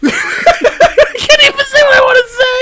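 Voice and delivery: high, breaking with laughter; real laughing fit, high; laughing hard. Real, not acted. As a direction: barely get it out through laughter. Laughing too hard to speak.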